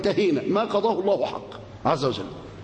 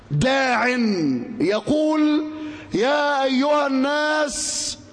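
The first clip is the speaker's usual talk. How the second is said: high, long-drawn herald's call; 6 semitones higher, much slower than usual. Acted. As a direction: call the crowd with stretched, sustained vowels. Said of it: "بينادي". (نداء ممدود بصوت عالٍ)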